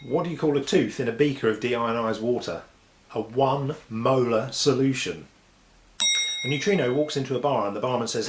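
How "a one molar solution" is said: slow, drawn-out punchline; slow deadpan punchline. Acted. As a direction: land the pun slowly. Punchline delivered slowly.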